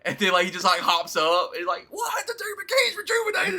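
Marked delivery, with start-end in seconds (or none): 1.9-3.6 high, excited wrestling-fan gasp; hammed-up shocked fan voice; mock shocked excitement. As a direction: squeal it like the crowd seeing him rise. Imitating Finn rising, excited.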